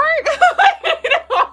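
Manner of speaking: loud high laughing burst; real laugh; high cackle. Real, not acted. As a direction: let out a big high laugh. Laughing after joke.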